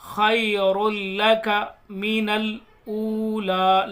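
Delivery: खैरुल लका मिनल ऊला read slowly, syllable by syllable; teacher's slow model reading; slow demo reading. Shown as a demonstration. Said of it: "नून लाम ज़बर नल".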